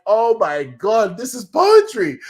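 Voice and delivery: high, slow, breathless wonder; awestruck gasp. Retold or imitated. Fan reliving the cliff scene, awestruck and slow. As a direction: marvel at it like a masterpiece. Awed reaction to finale scene.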